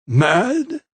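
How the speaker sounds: high offended question; indignant echo. A narrator's reading. Vicar echoing her accusation, indignant. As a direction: bristle at the word.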